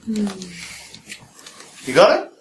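usual speaking voice

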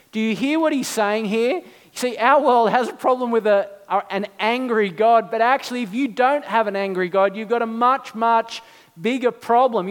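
ordinary talk, nothing marked

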